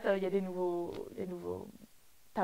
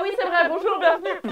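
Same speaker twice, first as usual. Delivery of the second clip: excited welcome; 7 semitones higher, 9 dB louder than usual. Real, not acted. Welcoming viewer after forgetting name.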